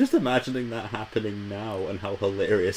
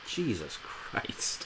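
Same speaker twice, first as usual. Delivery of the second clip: breathed out, slow and soft; Jesus Christ whispered in disbelief; whispered exasperation; exasperated whisper; 12 dB quieter, much slower than usual. Real, not acted. Exasperated reaction to Grumpy.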